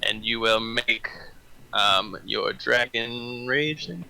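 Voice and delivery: ordinary delivery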